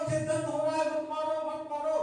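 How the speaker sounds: high, loud mock wail of pain; wailing plea. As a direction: plead as the hurting stone. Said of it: "ये भी रोने लगा".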